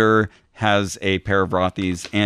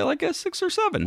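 excited high squeal; 4 dB quieter than usual. Real, not acted. Proud boast about confidence.

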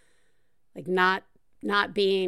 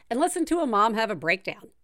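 ordinary talk

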